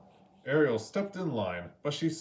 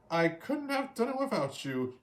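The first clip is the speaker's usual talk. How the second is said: higher, heartfelt Ariel voice; grateful Ariel; 10 semitones higher than usual. A narrator's reading. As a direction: thank friends sincerely. Ariel thanks friends.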